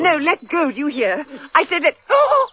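pulling away, voice climbing high in panic; alarmed cry. Acted. Woman fighting off a man's kiss, panicked high cry. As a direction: she shoves him off and cries out, high and frightened.

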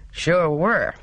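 "Sure were" said firmly and emphatically; said with emphasis. A narrator's reading. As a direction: lean hard on "Sure were". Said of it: "said Walter with emphasis".

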